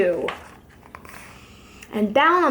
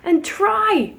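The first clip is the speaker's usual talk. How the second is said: higher-pitched, rallying appeal; raised, encouraging storybook voice; urging plea; 6 semitones higher than usual. Acted. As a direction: coax them to give it a try. Horton urging the Whos.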